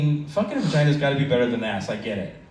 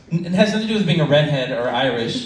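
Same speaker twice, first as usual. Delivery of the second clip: usual speaking voice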